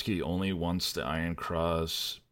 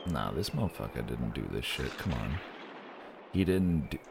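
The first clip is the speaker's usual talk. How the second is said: lower-pitched, dismissive colonel, lightly imitated; lightly voiced Brandt; 5 semitones lower, 3 dB quieter than usual. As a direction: drop the pitch for a quoted scoff. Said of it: "even Brandt's like".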